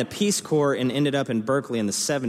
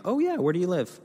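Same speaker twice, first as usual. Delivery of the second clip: pitched much higher, bright and eager; eager small talk; 9 semitones higher than usual. Retold or imitated. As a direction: play your own eager party chatter. Retold excited wedding small talk.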